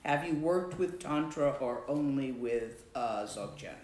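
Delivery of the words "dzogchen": ordinary delivery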